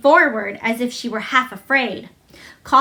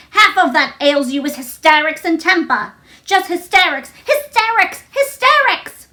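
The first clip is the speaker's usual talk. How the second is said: rising louder and higher with each hysterics; stamping scold; 6 semitones higher, 4 dB louder than usual. A narrator's reading. Mary scolding Colin, climbing higher on every repeat. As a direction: hammer the word home harder each time. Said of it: "she stamped each time she said it".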